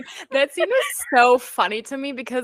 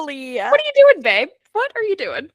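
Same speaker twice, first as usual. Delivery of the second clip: exasperated what are you doing; 8 semitones higher than usual. Real, not acted. Fan scolding Evie in exasperated disbelief. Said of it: "stop encouraging your enemies".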